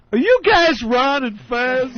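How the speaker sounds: excited fan. Retold or imitated. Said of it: "the guy next to us goes".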